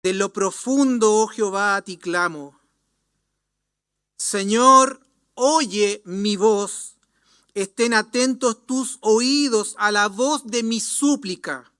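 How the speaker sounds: loud, slow psalm recitation; fervent scripture plea. Acted. Psalmist sorrowing over sin cries in prayer, fervent plea. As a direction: call up to God from the depths. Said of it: "expresó la gran tristeza por su pecado".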